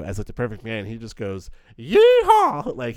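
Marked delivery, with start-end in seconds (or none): 1.8-2.8 a huge high whooping yeehaw, much louder; excited yeehaw. Retold or imitated. Joe hears the radio call him the perfect man, whoops. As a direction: whoop it like a thrilled cowboy. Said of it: "so excited".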